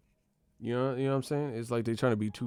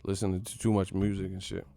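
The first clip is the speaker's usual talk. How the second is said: ordinary talk, nothing marked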